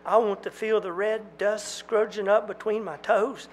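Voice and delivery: high, pleading outcry; desperate outburst. Acted. Jack pleading with the twins, high desperate outcry. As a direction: pour out longing desperately.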